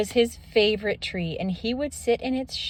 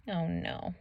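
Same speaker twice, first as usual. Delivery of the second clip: quiet dismay; 10 dB quieter than usual. Real, not acted. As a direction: mutter it under your breath. Aside as the men pick Ferdinand.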